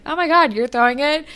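high, fast gushing; lightly mock a flattered girl; flattered Fiona. Fiona gushing at Adam, high and fast. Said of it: "she's like".

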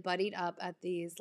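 ordinary talk

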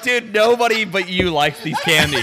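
high-pitched incredulous comeback; retold mock exasperation; exasperated. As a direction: protest in comic disbelief. Retold exasperated reply to candy lover.

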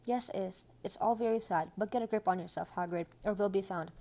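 usual speaking voice

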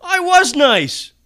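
high, loud, slow indignant whine; whiny protest. Real, not acted. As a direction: protest your innocence, drawn out. Protesting a friend's rebuke.

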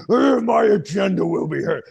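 high, hammed-up witch proclamation. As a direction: declare it as a scheming witch. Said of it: "the witch".

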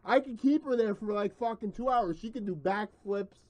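loud, fast, high bragging rant; excited boast. Real, not acted. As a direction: crank up the hype and brag. Boasting rant about spinning girl.

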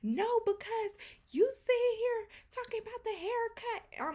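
high mocking mimicry of a complaint; imitating her upset. Upset haircutter protesting being laughed at, high-pitched imitation. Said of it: "she was so upset".

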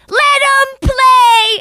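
put-on high, loud, stretched kid shout; long-drawn little-kid sideline yell; imitating a six-year-old heckler. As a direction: holler at the ref like a small child. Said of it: "Six years old".

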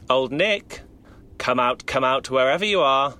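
loud high taunting sing-song; taunting call. Acted. Mob leader taunting Old Nick from outside, loud sing-song. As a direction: call him out of hiding, mocking.

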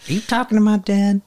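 put-on high-pitched Tennessee kid voice; little Tennessee boy. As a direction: imitate a small boy with a Tennessee twang. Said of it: "he sounds like a little Tennessee boy".

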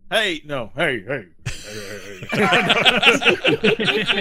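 trying out hollers, breaking into laughter; searching for a voice. Shown as a demonstration. Game master hunting for the sheriff's voice, laughing. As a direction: fumble through voices and crack up. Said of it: "I don't know what voice I'm doing".

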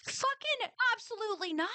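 high, firm, outraged refusal; emphatic refusal. Real, not acted. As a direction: shoot the idea down flat out. Ranting about MLM using tragedy.

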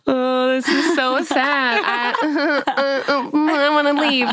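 hammed-up fake sobbing, high and drawn out; bad fake cry. Actress showing her failed audition crying, hammed-up fake sobs. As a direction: wail it slowly with badly faked sobs. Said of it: "I can't even fake laugh".

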